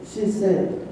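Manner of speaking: usual speaking voice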